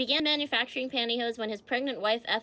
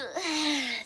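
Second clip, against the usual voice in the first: long audible yawn; real drawn-out yawn; 5 semitones higher than usual. Real, not acted. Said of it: "Sorry, I was yawning".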